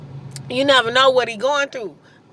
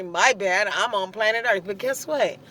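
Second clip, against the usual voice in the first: ordinary delivery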